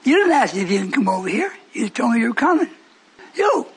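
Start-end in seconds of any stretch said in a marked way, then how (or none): 3.3-3.7 high, pointed YOU; single them out with a high YOU; emphatic YOU. Real, not acted. Preacher singles out the listener.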